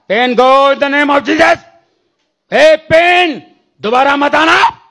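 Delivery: slow, loud, high rebuke of the pain; commanding prayer. Retold or imitated. Preacher rebuking the sick man's pain over the phone, loudly. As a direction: drag each word out like an order.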